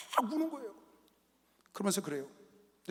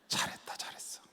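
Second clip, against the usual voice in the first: a soft aside of approval; whispered praise; 16 dB quieter than usual. Retold or imitated. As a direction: whisper it, pleased. Praising son quietly. (속삭이는 칭찬)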